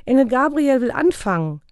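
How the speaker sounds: put-on high, loud children's announcement; children calling. A narrator's reading. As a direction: call father over like an eager child. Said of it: "riefen die Kinder".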